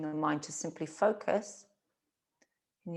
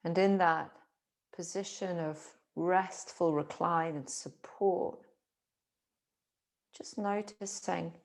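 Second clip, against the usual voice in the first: guided meditation. Acted. Teacher guiding awareness along spine and breath, calm meditation. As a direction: guide them gently into stillness, phrase by phrase.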